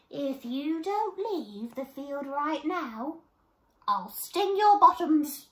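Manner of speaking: slow then loud, high little bee warning; bee threat. Acted. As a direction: buzz a cheeky threat at the goats.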